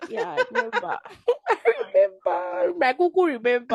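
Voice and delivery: high, laughing "I remember"; laughing reply. Retold or imitated. Friend asks about a prayer, laughing fake remembering. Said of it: "I'm like".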